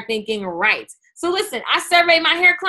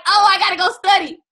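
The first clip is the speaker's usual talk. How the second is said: mock panic; 6 semitones higher, 5 dB louder than usual. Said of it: "I was like".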